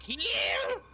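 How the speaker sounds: high shouted command; shouted spell. Pretend. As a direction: yell the spell with a rising pitch. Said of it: "cast Cure Wounds and be like".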